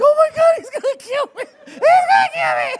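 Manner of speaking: very high laughing shriek; mock panic. Guest terrified of the angry wrestler, high laughing shriek. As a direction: squeal in mock terror.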